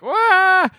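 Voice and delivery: loud, high comic wail; mock terrified wail. Imitating a man threatened over a dam, mock wail. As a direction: cry out like a hammed-up coward. Voices the threatened man's cry.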